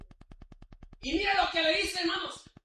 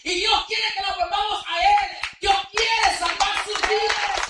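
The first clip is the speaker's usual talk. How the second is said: raised, ringing sermon voice; fiery preaching; 7 semitones higher, 7 dB louder than usual. Acted. Preacher pleading with the congregation, full-voiced preaching. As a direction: preach it out to the church.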